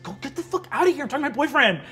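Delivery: high, loud, rapid yell; angry shooing. Acted. Girl chasing friends off her phone call, exasperated yell. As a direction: yell at your friends to clear off.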